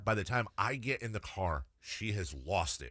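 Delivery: ordinary talk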